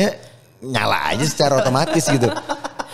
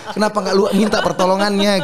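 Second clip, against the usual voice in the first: mock scolding; 9 semitones higher, 3 dB louder than usual. God scolding the drowned man.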